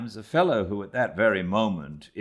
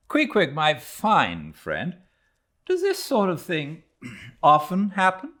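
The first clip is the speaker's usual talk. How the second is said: Ishmael asking; 9 semitones higher, 4 dB louder than usual. Acted. Narrator asks Queequeg after rescue.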